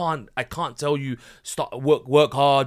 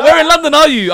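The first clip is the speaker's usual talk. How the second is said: high, loud, laughing put-on voice of an eager sheikh; hammed-up imitation of Mufti Monk, much higher and louder; Mufti Monk impression; 17 dB louder than usual. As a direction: play him excitedly asking where you are in London. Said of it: "He's like".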